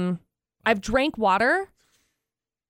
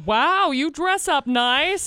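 slow, high, fake-sweet praise; backhanded compliment; 6 semitones higher, 3 dB louder, much slower than usual. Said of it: "Such a backhanded compliment".

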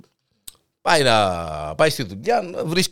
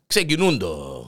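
normal talking voice

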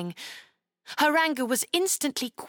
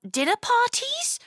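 put-on much higher innocent girl voice; innocent question; 14 semitones higher than usual. Chloe guessing to the knight, innocent and high. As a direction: ask it sweetly and naively. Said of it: "Chloe asked innocently".

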